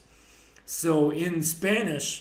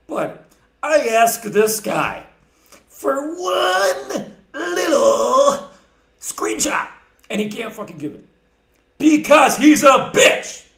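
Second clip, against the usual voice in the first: building to a furious shout, higher and louder; angry ranting shout; 8 semitones higher, 11 dB louder, slower than usual. Real, not acted. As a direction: rage at him, then explode on bitch. Ranting about opponent, building to shout.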